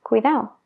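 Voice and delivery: "cuidado" said as cuidao, the last d gone; dropped d in cuidado. Shown as a demonstration. Teacher shows the casual Spanish cuidao. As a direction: swallow the d in "cuidado". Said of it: "a veces se omite".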